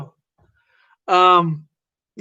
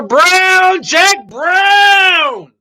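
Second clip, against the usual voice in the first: loud greeting call; 10 semitones higher, 8 dB louder, much slower than usual. Acted. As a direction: shout the name out high and loud. Host calls out a guest's name.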